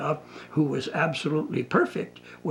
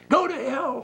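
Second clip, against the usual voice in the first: retold shout; 11 semitones higher than usual. Client shouting after the pillow out the window, retold high mock yell. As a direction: fling it out like a half-hearted yell. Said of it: "Shout something after it".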